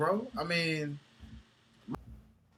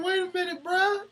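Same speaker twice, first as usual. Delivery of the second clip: much higher, squeaky voice of disbelief; high mock disbelief; 9 semitones higher than usual. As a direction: play it high like a shocked fan. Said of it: "It's like".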